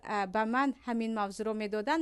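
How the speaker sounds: ordinary talk, nothing marked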